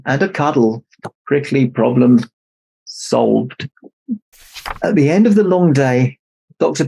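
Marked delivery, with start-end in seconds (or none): none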